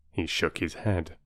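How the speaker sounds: plain reading voice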